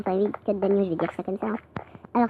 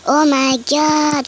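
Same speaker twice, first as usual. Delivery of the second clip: high, very drawn-out amazement; amazed; 5 semitones higher, 4 dB louder, much slower than usual. Real, not acted. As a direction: stretch it right out, thrilled. Amazed at eight diamonds. (émerveillement aigu, très étiré)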